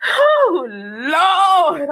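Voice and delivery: Oh, Lord with a crying catch; on the verge of tears, exasperated; crying out to the Lord over stolen packages; near tears. Real, not acted. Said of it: "I'm so mad. I'm about to cry".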